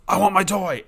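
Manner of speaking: want that toy like an eager pup; eager dog voice. Retold or imitated. Voicing dog wanting toy.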